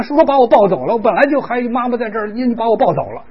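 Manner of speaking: put-on high-pitched complaining little child; mock toddler grievance; upset toddler's thoughts. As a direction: voice a small child's protest, high throughout. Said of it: "他心里想".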